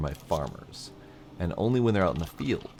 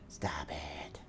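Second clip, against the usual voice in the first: much softer, whispered to the cat; 3 semitones lower, 13 dB quieter than usual. Real, not acted. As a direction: hush the cat under your breath. Telling the cat off.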